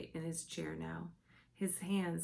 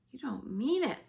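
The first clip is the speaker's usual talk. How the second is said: loud, higher shocked cry; 7 semitones higher, 6 dB louder than usual. A narrator's reading. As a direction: Sophie horrified. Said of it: "Sophie cried".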